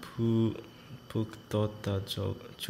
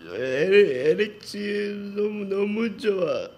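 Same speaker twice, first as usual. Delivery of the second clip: much higher, fast emotional cry of the name; touched squeal; 12 semitones higher, 5 dB louder, faster than usual. Real, not acted. Moved by a friend's letter, high gushing. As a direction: gush at a friend, voice jumping up.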